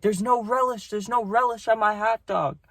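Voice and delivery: mimicking a cranky old customer; old lady. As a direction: squeak it like an irate old woman. Angry old lady voiced in story.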